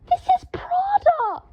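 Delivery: slow, very high outraged shriek. A narrator's reading. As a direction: shriek in indignant horror. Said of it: "shrieked Draco".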